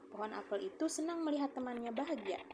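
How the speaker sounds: normal talking voice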